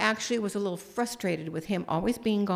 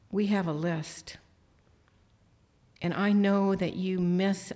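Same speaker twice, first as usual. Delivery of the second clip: closing prayer; 4 semitones lower than usual. Acted.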